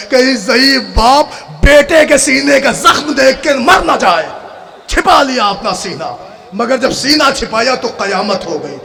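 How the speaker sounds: chanted, high-pitched mourning oration; lamenting zakir reciting to mourners; majlis recital. Acted. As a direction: preach the tragedy at full force, wailing.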